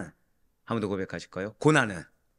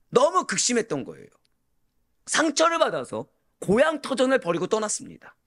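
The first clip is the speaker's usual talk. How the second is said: impassioned preaching; 8 semitones higher than usual. Real, not acted. Preacher driving the point home to the congregation, impassioned preaching. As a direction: preach it with mounting force, stretching each phrase.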